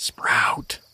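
hushed whisper of the name. Acted. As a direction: whisper it sharply to nudge him. Quiet aside to companion.

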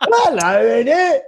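very high, laughing British-rocker put-on; comic high-pitched mom impression. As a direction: squawk it as a wild-haired rocker mom. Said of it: "look like fucking [name]".

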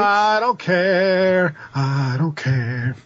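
drawn out, deliberate dismissal; slow and emphatic. Real, not acted. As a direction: say it slowly, over it. Repeated for emphasis.

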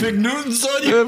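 blurted out through a laugh; laughing at the Fig Newton punchline. Real, not acted. Cracking up as the ex-perp greets him by name, laughing.

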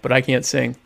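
ordinary delivery

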